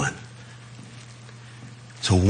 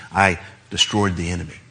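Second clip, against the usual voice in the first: ordinary delivery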